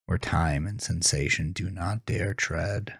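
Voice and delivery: usual speaking voice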